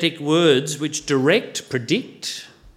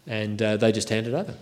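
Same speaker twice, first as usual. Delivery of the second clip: normal talking voice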